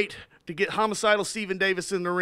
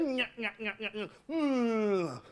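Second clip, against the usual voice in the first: Curly nyah and hum; 9 dB quieter, slower than usual. Acted. Curly signature noises.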